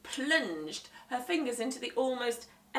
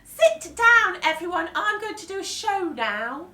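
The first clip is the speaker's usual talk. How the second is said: put-on higher, louder boastful boy; Henry announcing; 10 semitones higher, 12 dB louder than usual. Henry announcing his show, loud boy voice. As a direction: show off to the room.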